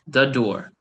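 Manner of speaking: usual speaking voice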